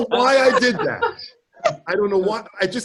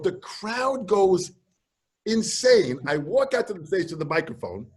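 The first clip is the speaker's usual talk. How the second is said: normal talking voice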